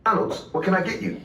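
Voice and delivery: a hint of a polite cashier. Narrator voicing the cashier.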